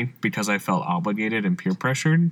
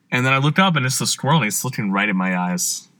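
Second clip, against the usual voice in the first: ordinary talk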